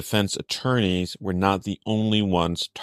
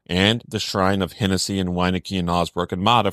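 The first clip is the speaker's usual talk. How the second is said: ordinary talk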